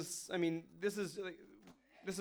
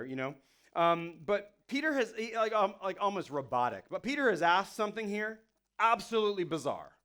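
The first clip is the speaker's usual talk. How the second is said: ordinary talk, nothing marked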